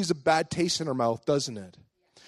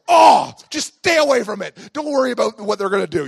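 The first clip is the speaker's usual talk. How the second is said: exasperated grandma; 3 semitones higher, 9 dB louder than usual. Retold or imitated. Grandma answers the boy's germ-stomping excuse, mock exasperation. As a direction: give up arguing as a fed-up grandma.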